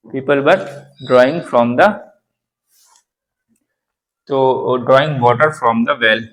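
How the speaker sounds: slow model sentence. Shown as a demonstration. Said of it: "model sentence".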